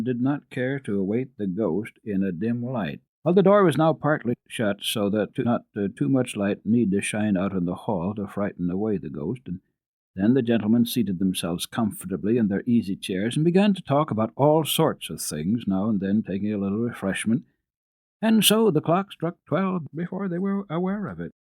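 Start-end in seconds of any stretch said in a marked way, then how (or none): none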